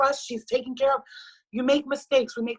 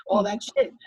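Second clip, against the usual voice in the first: ordinary delivery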